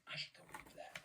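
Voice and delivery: hushed, realising under your breath; a man whispering a sudden idea to himself; whispered thought. Retold or imitated. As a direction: whisper it to yourself. Retold sudden private thought.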